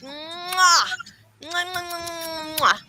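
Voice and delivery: string of air kisses; double air kiss. Real, not acted. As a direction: blow kisses at the camera. Blowing kisses goodbye.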